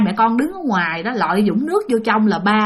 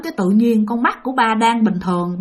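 ordinary talk

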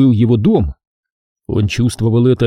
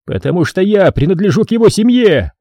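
shout; 8 semitones higher than usual. Acted. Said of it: "крикнул Тараон".

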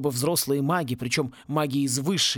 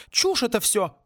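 snorting; 8 semitones higher than usual. A narrator's reading. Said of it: "фыркнула мисс Сьюзан".

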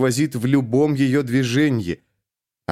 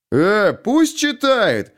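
displeased heckle; 10 semitones higher, 3 dB louder, much slower than usual. A narrator's reading. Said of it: "недовольный мужской голос".

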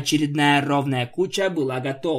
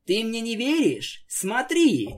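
playful teasing; 7 semitones higher than usual. Acted. Pinkie teasing Twilight.

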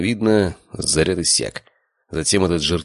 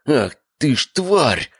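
high, drawn-out furious growl; angry growl; 10 semitones higher, much slower than usual. A narrator's reading. Father finding servant down and room breached, growling. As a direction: growl the curse slowly with rising pitch. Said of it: "зарычал отец".

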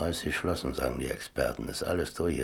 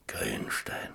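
soft, low whisper; 6 semitones lower, 6 dB quieter than usual. Acted. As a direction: murmur the name to yourself. Whispered name repeated.

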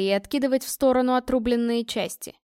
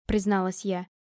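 usual speaking voice

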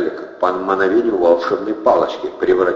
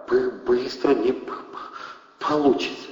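soft, higher-pitched, halting stutter; quiet stammer; 5 semitones higher, 6 dB quieter than usual. A narrator's reading. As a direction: stammer it softly, catching on the first sounds. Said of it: "тихо сказал армеец". (тихо, заикаясь)

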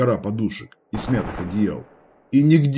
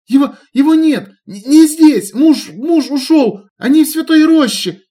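put-on high, loud panicked girl voice; frightened babbling; 8 dB louder than usual. A narrator's reading. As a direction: babble in terror, stumbling. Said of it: "залепетала".

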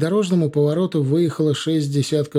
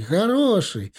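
"хороший!" jumps much higher, insistent; pleading merchant; 8 semitones higher, slower than usual. Acted. Merchant insists to officer, "хороший!" pitched high. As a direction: push "хороший!" up high to convince. Caravan man repeats word higher.

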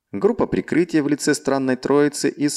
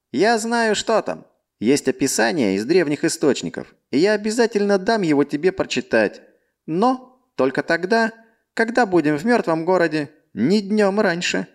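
scheming ally; 7 semitones higher than usual. Acted. Chris speaks to Mark.

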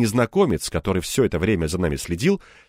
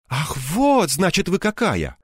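higher-pitched wounded sigh; reproachful, raised-pitch exclamation; 8 semitones higher, slower than usual. A narrator's reading. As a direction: sigh out a disappointed accusation. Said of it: "вздохнул Савелий Маркович".

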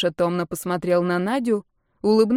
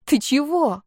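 higher, startled outburst; emotional exclamation; 7 semitones higher, slower than usual. A narrator's reading. Marta answers her cousin's apology, exclaiming. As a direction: burst out in warm protest. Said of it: "воскликнула Марта".

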